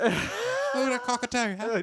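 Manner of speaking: very high, slow squawking bird voice; the farmer turns into a squawking cockatoo. Acted. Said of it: "That's pretty good, that one".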